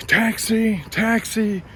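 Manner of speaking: high, nagging taxi tout call; mock tout calling taxi; pushy tout calling. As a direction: hawk the word at him like a street tout. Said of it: "she's circling me".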